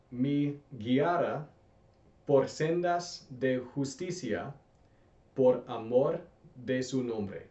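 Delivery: slow, deliberate Spanish read for repeating; teacher modeling the verse slowly for students. Shown as a demonstration. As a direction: read it slowly so learners can echo each word. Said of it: "I'm gonna read this slowly".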